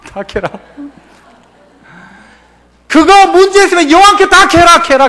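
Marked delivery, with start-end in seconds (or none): 2.9-5.1 loud, high Gyeongsang 케라 command; booming dialect exhortation; shouted dialect command. Acted. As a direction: call it out over the congregation.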